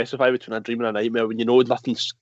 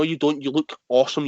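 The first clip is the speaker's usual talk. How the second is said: usual speaking voice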